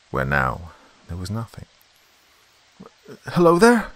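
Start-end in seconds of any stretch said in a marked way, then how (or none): 3.3-3.9 loud, high hail into the dark; raised, carrying call. A narrator's reading. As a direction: call to someone out of sight. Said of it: "I called".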